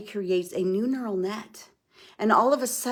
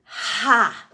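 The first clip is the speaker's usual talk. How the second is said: a single sharp loud "Ha!" pushed out on the exhale; 2 semitones higher, 7 dB louder than usual. Shown as a demonstration. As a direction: chop down and bark out one quick ha. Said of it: "we're gonna make a swift movement".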